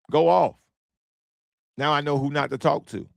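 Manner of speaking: ordinary delivery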